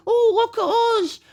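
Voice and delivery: very high, simpering put-on voice; mocking high-pitched do-gooder voice; mock advertiser. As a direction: mimic the company in a squeaky sing-out. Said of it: "They're trying to say".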